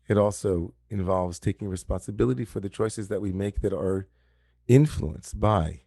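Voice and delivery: normal talking voice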